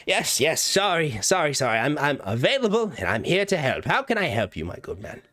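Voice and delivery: high, bright, apologetic little voice; eager gnome shopkeeper. Acted. Gnome shopkeeper calling to a customer, high eager voice. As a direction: hurry out to help a customer. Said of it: "a voice calls out and says".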